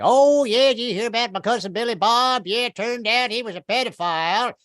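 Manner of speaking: dish the dirt in a high, chatty voice; comic gossip spilling family news; gossipy southern woman. Retold or imitated. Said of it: "She was like".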